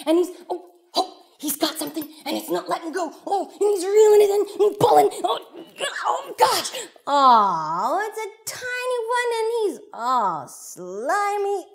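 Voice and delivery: high, breathless play-by-play of a catch; animated grunting exclamations, then disgust; straining excitement. Acted. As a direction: act out hauling in a stubborn fish. Boy struggles reeling in fish.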